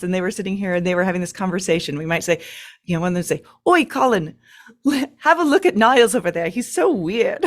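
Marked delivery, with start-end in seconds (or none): 3.7-7.4 a hammed-up, higher cockney English accent, teasing a weird moth; gossiping moth in a deliberately terrible English accent; bad English accent. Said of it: "attempt to do an English accent".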